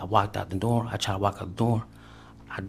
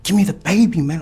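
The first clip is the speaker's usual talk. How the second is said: much higher, loud yelled demand; shouted demand; 9 semitones higher, 10 dB louder than usual. Retold or imitated. Man retelling yelling at girlfriend for the baby, loud and high. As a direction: yell it at her, all out. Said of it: "And I'm like".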